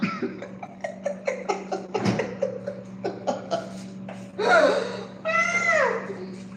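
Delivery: long high-pitched laughing fit; helpless laughter. Real, not acted. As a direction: crack up into high wheezes. Laughs at the language problem.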